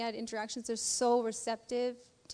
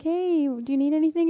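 higher, louder cheery-voiced approach; retold friendly call, pitched up; acted offer of help; 6 semitones higher, 8 dB louder than usual. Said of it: "so I'm like".

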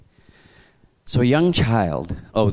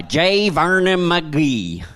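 Vernon McGee's drawl; 6 semitones higher, much slower than usual. Retold or imitated. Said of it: "That's, that's the way he talks".

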